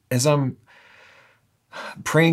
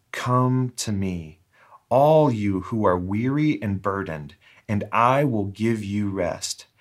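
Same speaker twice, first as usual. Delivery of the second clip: unhurried, measured scripture reading; slow, deliberate reading with falling line ends. Real, not acted. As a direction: read slowly over the listeners, pausing between phrases. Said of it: "read this a couple times slowly".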